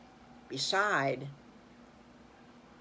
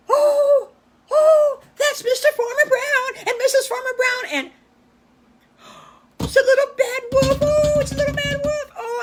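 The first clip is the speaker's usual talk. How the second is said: very high, loud squealing piglet; a little pig shrieking with delight; excited piglet; 14 dB louder than usual. Acted. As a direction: piglet seeing Farmer Brown and the wolf, excited shriek. Piglet spots the visitors.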